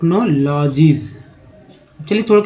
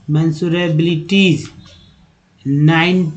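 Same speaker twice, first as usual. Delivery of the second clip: ordinary talk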